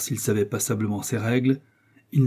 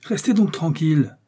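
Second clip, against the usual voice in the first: higher, indignant order; 6 semitones higher than usual. A narrator's reading. Indignant teacher ordering the class to keep still, higher. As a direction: scold them into silence. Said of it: "continuait le professeur indigné".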